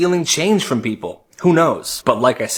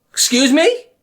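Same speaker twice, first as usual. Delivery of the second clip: high, louder offended squawk; indignant surprise; 11 semitones higher, 4 dB louder than usual. Acted. Bully Chad outraged at Kyle's reply, high indignant squawk. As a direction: act shocked he talked back.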